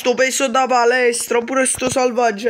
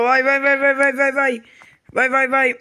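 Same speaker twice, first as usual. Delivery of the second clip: ordinary talk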